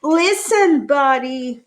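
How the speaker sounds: loud, high call of his name; yelling. Acted. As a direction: shout it to get his attention. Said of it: "His parents tried yelling".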